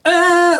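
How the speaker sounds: very high squealing device tone; imitated high squeal. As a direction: copy the meter shrieking upward. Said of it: "I don't know why that is, 'cause it'll be like".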